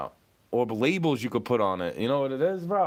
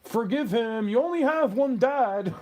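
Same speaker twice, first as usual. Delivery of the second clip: mock preachy advice; 6 semitones higher, 3 dB louder than usual. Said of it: "you wouldn't say".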